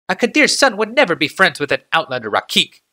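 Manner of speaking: careful servant choosing his words, pitched high; Hajar's voice. A narrator's reading. Hajar answers the Kadir.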